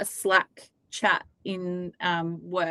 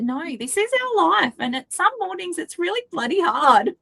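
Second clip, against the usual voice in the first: high-pitched, strained emphasis; exasperated venting; 10 semitones higher than usual. Real, not acted. Parent admitting some mornings are hard, high exasperated voice. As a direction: let the voice climb high with exasperation.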